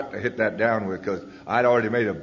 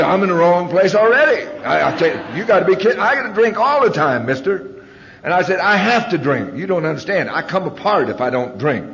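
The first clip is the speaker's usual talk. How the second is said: much higher and louder, protesting hard; indignant refusal; 8 semitones higher, 7 dB louder than usual. Retold or imitated. Told not to drink all day, loud indignant protest. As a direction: push back hard, disbelieving the request.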